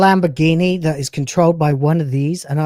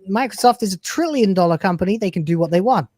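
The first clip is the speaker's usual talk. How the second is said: ordinary talk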